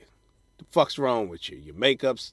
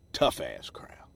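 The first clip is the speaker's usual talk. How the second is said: low whispered tough crowd; hushed rueful aside; 10 semitones lower, slower than usual. Real, not acted. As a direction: confide it in a whisper.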